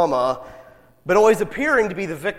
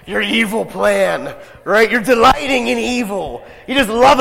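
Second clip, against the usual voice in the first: villain voice; 6 semitones higher, 7 dB louder than usual. Pretend. Villain voice after evil laugh.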